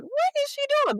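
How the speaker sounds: high mock bewildered squeak; puzzled what. Choreographer's puzzled reaction, imitated.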